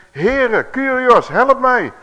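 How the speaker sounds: high, loud cry for help; acted shouting. Woman falling at the Lord's feet, shouting for help. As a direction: shout the plea out, pitch leaping up. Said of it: "Ze begint te schreeuwen".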